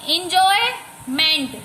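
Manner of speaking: "Enjoyment" slowly, pausing before meant; teacher sounding out "enjoyment" part by part; syllable split. Shown as a demonstration. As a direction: break "enjoyment" into spaced chunks. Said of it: "बोल के repeat करके देखना है".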